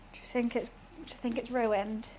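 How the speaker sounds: normal talking voice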